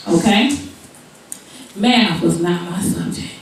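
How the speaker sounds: ordinary delivery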